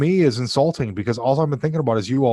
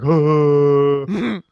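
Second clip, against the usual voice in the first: mocking dopey friend voice; 3 semitones higher, 6 dB louder, much slower than usual. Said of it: "and you were like".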